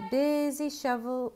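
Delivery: ordinary delivery, nothing marked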